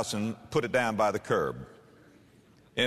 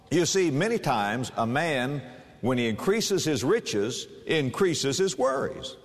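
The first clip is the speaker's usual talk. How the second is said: ordinary talk, nothing marked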